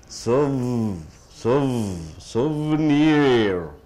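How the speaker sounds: Souvenir with a long buzzing v after soo; held V sound. Shown as a demonstration. Teacher drilling a middle consonant, drawn-out V sound. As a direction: hold the v in Souvenir, then a slow NEER. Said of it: "you say v".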